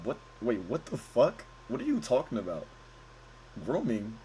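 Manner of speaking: puzzled and hushed. Real, not acted. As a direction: say it under your breath, confused. Baffled at the chat's word.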